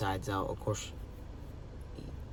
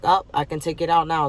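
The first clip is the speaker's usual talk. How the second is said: higher, louder, quicker retold nurse; sudden realization; 6 semitones higher, 8 dB louder, faster than usual. Nurse tells the patient she can remove it now, sudden realization. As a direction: imitate someone just realizing it, pitched up.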